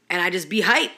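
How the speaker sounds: ordinary delivery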